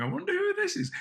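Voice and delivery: sudden high squeaky wondering; a high, curious mock thought; mock inner voice. Imitating masked singers' thoughts.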